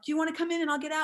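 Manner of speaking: fast, high eager offer; offering the man the elevator, fast and high; hurried offer. Retold or imitated. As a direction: rush the polite offer out. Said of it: "I'm like".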